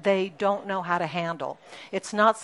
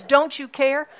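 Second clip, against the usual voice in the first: louder, higher anxious cry; disciples' panicked plea; 6 semitones higher, 6 dB louder than usual. Retold or imitated. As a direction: plead like scared disciples. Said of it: "the disciples say to Jesus".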